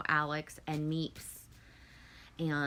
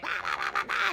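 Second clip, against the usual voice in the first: mocking scolding mom; 3 dB louder, faster than usual. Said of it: "be like".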